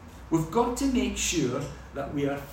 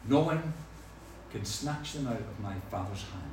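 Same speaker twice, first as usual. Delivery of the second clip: softer, lower quote of Jesus; quiet reverent quote; 7 semitones lower, 5 dB quieter than usual. Retold or imitated. Preacher reading Jesus' promise to the flock, softly. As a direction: let it fall gently, reassuringly. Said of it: "Jesus says".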